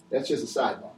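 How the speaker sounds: ordinary talk, nothing marked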